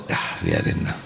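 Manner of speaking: unvoiced, soft let-it-go; whispered dismissal; whispered aside. Real, not acted. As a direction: breathe it out, brushing it off.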